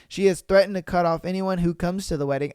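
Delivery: normal talking voice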